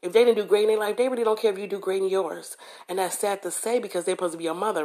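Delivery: ordinary delivery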